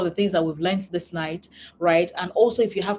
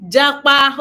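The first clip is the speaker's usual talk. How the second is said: loud, higher burst on Japa; amused exclamation; 5 semitones higher, 9 dB louder than usual. Real, not acted. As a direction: blurt it out amused. Reads amused chat goal aloud.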